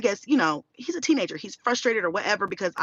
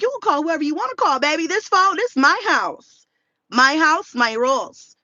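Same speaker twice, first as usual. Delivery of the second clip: high, loud retold scolding; laying down law; 7 semitones higher, 5 dB louder than usual. Mother tells son who rules the house, loud retold scolding.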